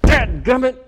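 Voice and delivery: high, hammed-up burst of temper; mock angry outburst. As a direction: curse as if hurling something down. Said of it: "threw it down on the floor".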